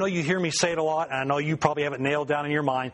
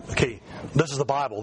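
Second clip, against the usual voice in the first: normal talking voice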